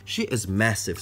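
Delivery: usual speaking voice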